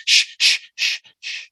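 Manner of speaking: loud unvoiced shushing, then fading; hushing shh. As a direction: shush the room like a student. Room being hushed.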